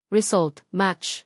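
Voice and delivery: ordinary delivery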